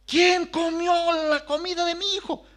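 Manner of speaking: put-on mother interrogates children about stolen food, high and accusing; angry mother's demand. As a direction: confront the guilty kids. Mother demands who ate food.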